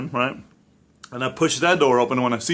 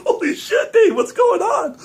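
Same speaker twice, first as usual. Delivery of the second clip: laughing retelling; 5 dB louder than usual. Retold or imitated. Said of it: "they're like".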